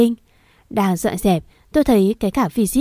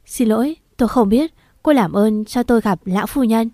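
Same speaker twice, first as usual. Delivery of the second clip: plain reading voice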